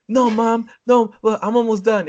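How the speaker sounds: loud, high mock whining; high-pitched kid. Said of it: "With very high-pitched voice".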